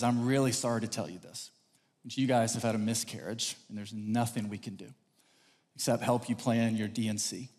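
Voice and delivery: low, soft bad-news voice; solemn sympathy. Doctor telling couple of miscarriage, solemn. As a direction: break it gently and gravely. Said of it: "the doctor's demeanor changes completely".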